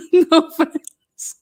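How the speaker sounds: laughing through a mock apology; giggling out no offense, high and loud. Real, not acted.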